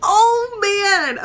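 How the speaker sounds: ordinary talk, nothing marked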